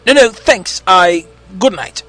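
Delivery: bow out hastily with broken phrases; flustered stutter. A narrator's reading. Andrew backs out of the phone call, flustered. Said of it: "Andrew stuttered".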